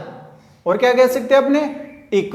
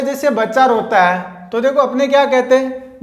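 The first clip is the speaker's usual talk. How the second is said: usual speaking voice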